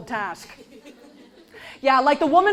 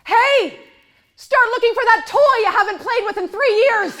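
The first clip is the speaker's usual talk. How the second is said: loud, mocking, higher; sarcastic; 7 semitones higher, 5 dB louder than usual. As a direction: tease them with fake cheer. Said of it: "what they must hear".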